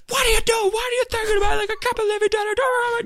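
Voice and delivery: very high, hammed-up scolding rant; mock screaming. Comedian telling off a kid, mock screaming. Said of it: "he's screaming at him".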